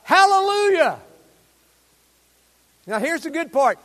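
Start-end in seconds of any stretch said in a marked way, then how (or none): 0.0-1.0 high, loud cry of praise; loud hallelujah. Shown as a demonstration. Preacher leading the congregation in a loud hallelujah. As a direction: shout it out with the congregation. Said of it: "would y'all read it with me".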